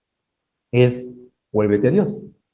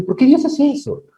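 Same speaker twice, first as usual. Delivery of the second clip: higher, puzzled mock whine; a light imitation of a confused complainer; mock complaint; 12 semitones higher than usual. Preacher voicing a believer complaining about God, lightly higher.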